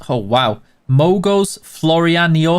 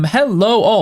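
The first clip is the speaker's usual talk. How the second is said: ordinary talk, nothing marked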